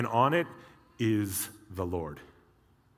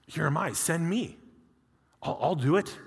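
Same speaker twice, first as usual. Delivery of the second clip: higher-pitched, keen offer to serve; eager volunteering; 7 semitones higher than usual. Acted. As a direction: pipe up as a willing volunteer. Acting Isaiah eagerly volunteering.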